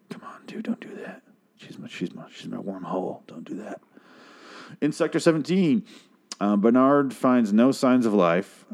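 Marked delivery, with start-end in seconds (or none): 0.1-3.8 urgent whisper, barely voiced; hushed plea. Coworker quietly begging Lee to stop, in a hushed whisper. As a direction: whisper it so nobody overhears.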